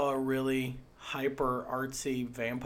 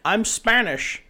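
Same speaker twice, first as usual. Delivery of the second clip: lightly mimicked film star, pitched up and slow; Sean Connery; 8 semitones higher, 6 dB louder, slower than usual. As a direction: draw it out in a higher, louder mock hero voice. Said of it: "Sean Connery shows up and he's like".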